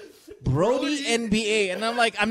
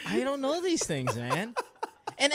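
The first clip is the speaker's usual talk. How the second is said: ordinary talk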